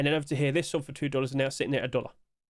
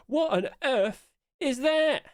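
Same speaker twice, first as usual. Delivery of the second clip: incredulous; 10 semitones higher, 3 dB louder, much slower than usual. Real, not acted. Shocked at a silly skin.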